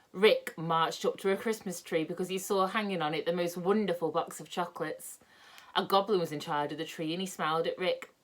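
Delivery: usual speaking voice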